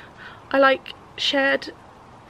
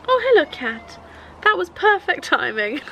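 greeting the cat; 7 semitones higher, 3 dB louder than usual. Real, not acted.

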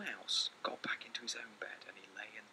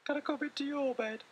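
much higher pleading little voice; little mouse asking; 7 dB louder than usual. Acted. Little Mouse asking Big Mouse to share his bed, high small plea. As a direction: beg like a tiny mouse. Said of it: "asked Little Mouse".

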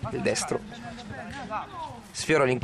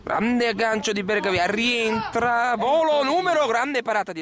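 excited; 10 semitones higher, 3 dB louder than usual. Acted. Building action call.

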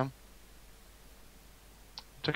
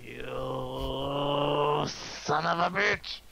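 slow motion speech; much slower than usual. Pretend. Slow motion game taunt.

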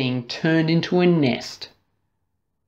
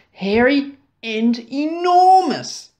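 rising, stretched enormous; building emphasis; 9 semitones higher, 3 dB louder than usual. A narrator's reading. Narrator stressing the fly's size, building.

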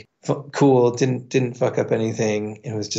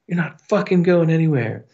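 imitating a bouncer, higher-pitched threat; angry order; 6 semitones higher than usual. Bouncer throws drinker backstage, angry order. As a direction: lay down a retold order in a raised pitch.

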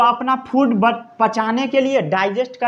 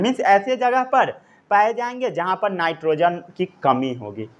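usual speaking voice

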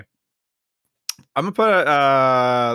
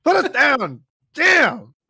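high, loud exasperated mock yelling; furious shout; 13 semitones higher, 6 dB louder than usual. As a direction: scream at the clown to land the balloon. Yelling at Pennywise in balloon.

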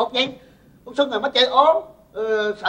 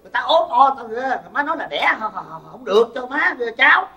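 louder and higher, protesting his mother again and again; exasperated complaint; 6 semitones higher, 5 dB louder than usual. Acted. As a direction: whine back at mom, fed up. Son protests mother's rejections. (cãi lại má, to tiếng và bực bội)

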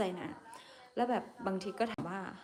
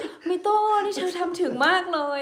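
much higher, louder, gushing praise voice; coworkers' praise; 10 semitones higher, 8 dB louder than usual. Retold or imitated. Coworkers gushing over the streamer's bold English, in a high, loud gushing voice. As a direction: gush out the compliment through laughter. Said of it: "แล้วพี่ๆเขาบอกว่าไงรู้ปะ".

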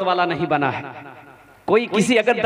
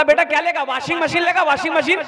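higher, eager father asking; lightly voiced eager bride's father; coaxing father; 8 semitones higher than usual. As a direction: sweet-talk the groom like the eager father.

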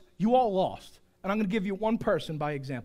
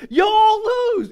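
mock-triumphant shout; 13 semitones higher, 12 dB louder than usual. Preacher teasing congregation about losing, shouted. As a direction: holler it like a gleeful verdict.

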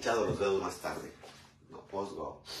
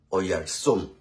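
usual speaking voice

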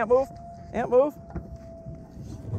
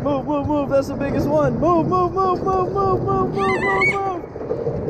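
much higher, rising urgent calls; urgent repeated move; 14 semitones higher, slower than usual. Real, not acted. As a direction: hurry them out of the way. Urgent call while landing fish.

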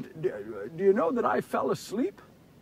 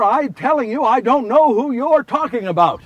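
louder, exasperated denial; insistent denial; 3 semitones higher, 6 dB louder than usual. Acted. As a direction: push back firmly at the accuser. Second, insistent denial to the woman.

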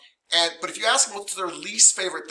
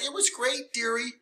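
mock sweet grandmotherly voice, much higher; sweet old mom; 9 semitones higher, 4 dB quieter than usual. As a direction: imitate a doting mom saying dearie. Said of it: "your mom who's like".

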